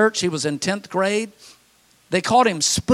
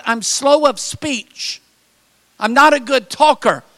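higher, slow, pleading Moses voice; Moses pleading excuse; 5 semitones higher, 4 dB louder than usual. Acted. Pastor voices Moses begging off, high and slow. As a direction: drag out the excuse, high and halting.

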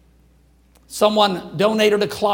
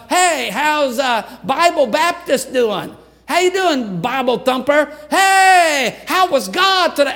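higher, louder jeering call, a shout on hey; mocking taunt; 6 semitones higher, 4 dB louder than usual. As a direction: taunt them like a neighborhood bully. Neighborhood teens mocking church kids.

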